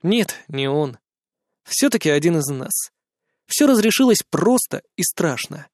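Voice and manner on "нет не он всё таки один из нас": ordinary delivery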